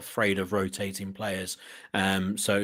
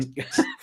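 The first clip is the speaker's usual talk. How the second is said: a sudden high squeal of a laugh; giggling squeal of delight; burst of laughter. Real, not acted. Laughing at defender who scores.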